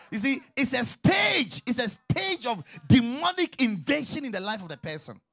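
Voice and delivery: loud, high, drawn-out preacher's warning; full-force preaching. Real, not acted. Pastor warns church of demonic invasion, full-force preaching. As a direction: thunder it out to the congregation.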